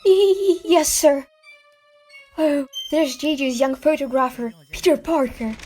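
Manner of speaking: high, flustered secretary; timid, stuttering assent; nervous stammer. Acted. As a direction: stumble over the first word, intimidated. Secretary cowed by angry boss.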